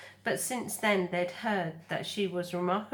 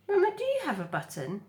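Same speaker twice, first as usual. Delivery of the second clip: high gosling voice; 10 semitones higher, 4 dB louder, faster than usual. Acted. Gosling voice in read story.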